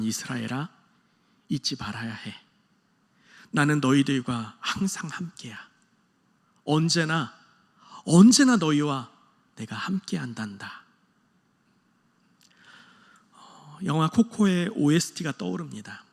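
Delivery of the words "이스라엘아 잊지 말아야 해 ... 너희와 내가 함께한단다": a parent gently promising to stay near; tender parental promise. Retold or imitated. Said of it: "떠나보내는 부모의 심정". (떠나보내는 부모가 자녀에게 속삭이듯 다정하게)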